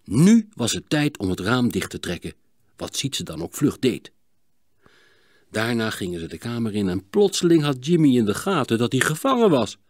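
ordinary delivery, nothing marked

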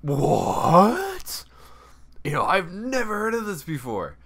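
high, loud squeal of disbelief; incredulous, pitch shooting way up; shocked. Retold or imitated. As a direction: shriek it in hammed-up shock. Acted shock at threesome news.

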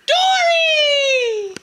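loud excited call. Pretend. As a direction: announce the big reveal. Big reveal calling Dory.

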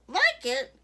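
loud, high, outraged bellow; scandalised storybook bellow. A narrator's reading. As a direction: bellow it back in disbelief. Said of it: "bellowed Missus McSnoot".

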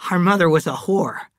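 bitter confession, pitched a little high. A narrator's reading. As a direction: spit out the ugly family secret, firmly. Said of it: "said Cal bitterly".

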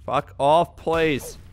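normal talking voice

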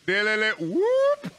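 high, rising "whoop"; loopy high-pitched whoop; imitated game noise. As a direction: mimic a strange creature call. Mimics the odd game sound.

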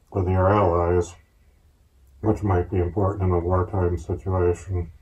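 muffled voice inside mask; talking behind full respirator; speaking through a gas mask. Real, not acted. Seen 4: Gas mask with side filter worn over whole face throughout.

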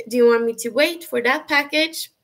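ordinary talk, nothing marked

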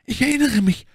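high, strained female voice; stammering woman. A narrator's reading. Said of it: "stammelte Madame Bonacieux". (hohe, stammelnde Frauenstimme)

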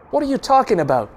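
fast, high, loud retort. A narrator's reading. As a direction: snap back at him. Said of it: "Doc retorts".

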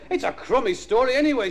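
high-pitched stubborn grumble. A narrator's reading. Dougal objecting to the story, mock stubborn. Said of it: "said Dougal stubbily".

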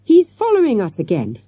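high and loud, falling away; excited yell. A narrator's reading. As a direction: cry out in alarm. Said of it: "Matthew yelled".

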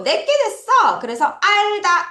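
high, loud burst of discovery; delighted realization. Acted. Teacher acts out a learner who has mastered it, excited realization. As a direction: exclaim it like it just clicked.

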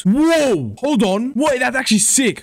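high, thrilled outburst; amazed. Real, not acted. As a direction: blurt it out high in real amazement. Amazed by discovery in game.

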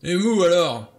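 high, loud angry comeback; angry retort. A narrator's reading. Blore throws it back at Lombard, angry. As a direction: fling it back furiously. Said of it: "lança-t-il avec colère".

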